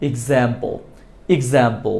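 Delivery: "এক্সাম্পল" as ig-zaem-pol, starting with i, falling; correct pronunciation. Shown as a demonstration. Teacher models example correctly for learners, correct pronunciation. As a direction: say "এক্সাম্পল" low and soft, opening on "ig". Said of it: "আর সঠিকটা কিভাবে শিখেছি".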